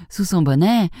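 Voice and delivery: ordinary delivery, nothing marked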